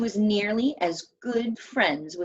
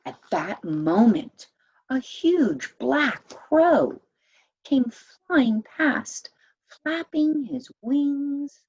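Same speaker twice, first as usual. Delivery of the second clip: louder, slow and ominous; slow, loud storyteller suspense; dramatic narration; 5 semitones higher, 5 dB louder, slower than usual. A narrator's reading. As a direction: build menace as the crow arrives. Crow swoops in dramatically.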